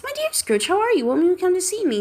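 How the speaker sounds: high, bright friendly greeting; high cheerful call of a friend. Acted. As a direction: hail him warmly from the street. Neighbor's cheerful greeting voiced.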